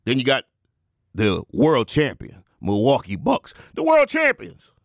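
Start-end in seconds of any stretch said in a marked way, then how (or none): 3.8-4.7 high, ringing champion's billing; hyped announcement. Acted. The Rev bills the Bucks as champions, high hyped cry.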